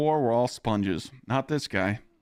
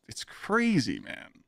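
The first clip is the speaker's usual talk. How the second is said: ordinary delivery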